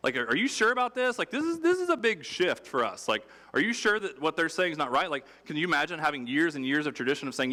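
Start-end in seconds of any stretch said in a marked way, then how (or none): 0.2-5.1 much higher, pleading "are you sure" voice; anxious disbelief. Acted. Disciples nervously asking Jesus if he is sure, high and anxious. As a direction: play the worried disciple second-guessing the teacher.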